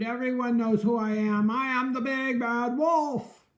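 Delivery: loud proud wolf shout; boastful wolf shout. A narrator's reading. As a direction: bellow his own name. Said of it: "he shouted".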